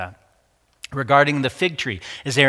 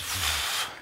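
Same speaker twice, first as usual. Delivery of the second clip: low, breathy puff of air; acted sigh of someone stuck; pondering sigh; 7 semitones lower, 8 dB quieter than usual. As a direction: blow out a stumped breath. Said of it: "And Ezekiel thinks".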